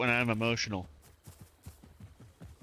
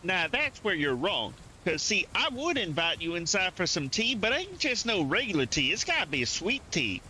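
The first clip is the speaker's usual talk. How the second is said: thick Southern accent; 5 semitones higher than usual. Shown as a demonstration. Host inviting you inside for sweet tea, thick Southern accent. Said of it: "a thick accent like that hiding".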